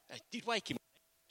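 normal talking voice

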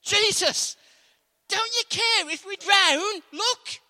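high, loud, slow frantic plea; desperate plea. Acted. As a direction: beg him loudly, terrified of drowning. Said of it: "Listen to what the disciples did".

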